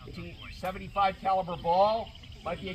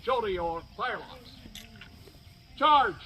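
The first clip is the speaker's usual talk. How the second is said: shouted command; 5 semitones higher, 4 dB louder than usual. Acted. Bayonet charge command.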